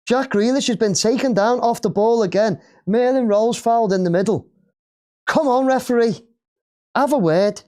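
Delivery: high, heated complaint at the referee; exasperated appeal. Real, not acted. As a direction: protest the foul. Complaining about fouls to the referee.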